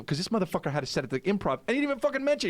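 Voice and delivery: fast, high, aggrieved rant; indignant rush of complaint; heated. Retold or imitated. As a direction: retell it all worked up. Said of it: "I'm ready to get heated".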